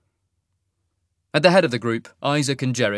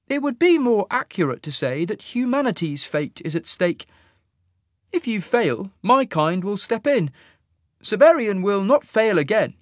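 much higher, measured, louder officer voice; cool alien officer; 9 semitones higher, 4 dB louder than usual. Acted. Fade officer to Sasha, a cool higher suggestion. Isaac replies to Jarek.

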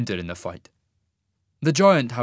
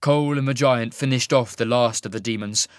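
usual speaking voice

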